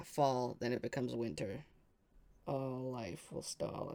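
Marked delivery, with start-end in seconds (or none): 2.5-3.9 soft, slow murmur to yourself; musing mutter. Real, not acted. Player repeats the clue to herself, softly and slowly. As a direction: turn the clue over under your breath, slowly. Repeating the riddle while thinking.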